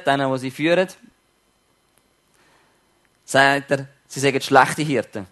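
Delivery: normal talking voice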